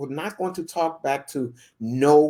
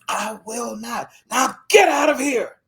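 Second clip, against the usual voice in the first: building to a shout; 8 semitones higher, 6 dB louder than usual. Retold or imitated. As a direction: start cold, then explode. Landowner refusing again, building.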